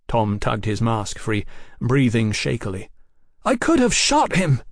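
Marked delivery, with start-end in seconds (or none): 3.4-4.6 high, thin blurt; thin-voiced blurting. A narrator's reading. Young brother confessing to his elder, blurting thinly. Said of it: "he blurted, his voice thin".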